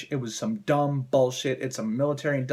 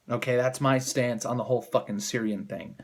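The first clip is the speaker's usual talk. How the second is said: normal talking voice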